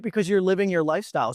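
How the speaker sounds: normal talking voice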